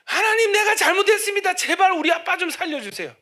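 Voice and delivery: pleading cry, high and loud; desperate shouted prayer. Retold or imitated. Son begging God for his father, loud crying prayer. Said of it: "소리를 지른 거예요".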